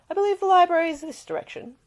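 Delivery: courteous uncle showing the way; lightly voiced aristocrat. Narrator voicing Isleston.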